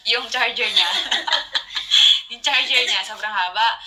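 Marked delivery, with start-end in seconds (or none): 0.1-2.3 laughing through the words; giggling as you start the story. Real, not acted. Retelling funny charger story. (tumatawa habang nagsasalita)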